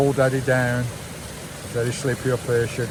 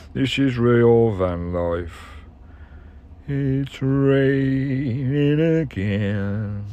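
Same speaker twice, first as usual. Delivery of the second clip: weary mock complaint; much slower than usual. Rainy day, weary complaint.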